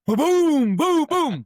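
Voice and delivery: loud, high mock blasts; imitated explosions. Imitating breaching charges in the background, as loud mock booms. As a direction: make the breach noises big.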